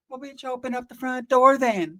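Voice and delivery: high, drawn-out put-on whine; slow, high-pitched sass; mocking retort. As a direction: answer back in a high mimicking voice. Mock retort to the yelling mom.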